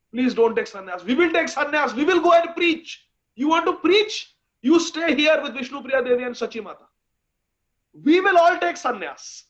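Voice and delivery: impassioned high, loud pleading; crying and begging. Acted. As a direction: plead fervently, voice climbing as you argue. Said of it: "they are all crying and begging".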